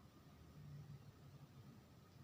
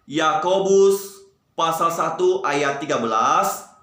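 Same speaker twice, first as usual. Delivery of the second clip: loud, high, slow recitation; raised voice, drawn-out rallying recitation; 5 semitones higher, 6 dB louder, slower than usual. Shown as a demonstration. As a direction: project every phrase loudly for the group to follow. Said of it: "Kita sebutkan lagi dengan suara yang keras".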